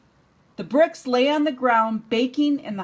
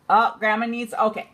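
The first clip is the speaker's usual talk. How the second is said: ordinary delivery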